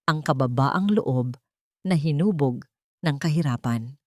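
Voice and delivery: ordinary delivery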